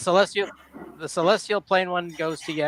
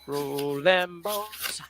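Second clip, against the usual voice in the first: very slow, stretched-out words; drawn-out dice call; 4 dB quieter, much slower than usual. Pretend. Player calling for the healing roll, slow and drawn out. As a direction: stretch out each word like a slow chant.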